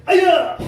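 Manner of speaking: very loud piercing high yell; kiai shout. Shown as a demonstration. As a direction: explode the yell on the break.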